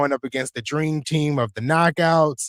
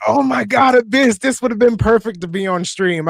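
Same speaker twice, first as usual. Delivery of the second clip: loud excited squeal; excited shout; 9 semitones higher, 6 dB louder than usual. Retold or imitated. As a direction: shout it like you just saw it. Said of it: "I was like".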